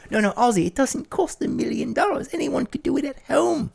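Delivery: high, scolding Sharon; high Sharon lecture; Sharon impression. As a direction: correct him in a high Sharon voice. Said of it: "play all three parts".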